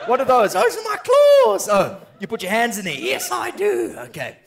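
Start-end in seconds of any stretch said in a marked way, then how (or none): 0.5-1.7 much higher cartoon wolf voice; a wild puppet wolf yelling. 2.9-4.0 much higher, slow puppet wolf drawl. Acted.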